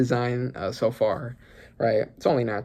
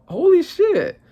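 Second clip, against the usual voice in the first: stunned; 4 dB louder, slower than usual. Real, not acted. Reacting to collapse.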